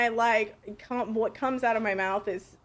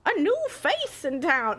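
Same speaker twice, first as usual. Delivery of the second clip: higher, drawn-out try at an Irish accent; attempted Irish accent; 5 semitones higher than usual. Shown as a demonstration. Streamer trying out a character voice, attempted Irish accent. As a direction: stretch it into a would-be Irish lilt. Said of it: "I don't think it really sounds like Irish to begin with".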